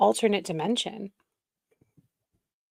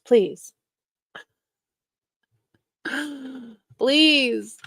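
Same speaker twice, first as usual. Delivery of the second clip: ordinary talk, nothing marked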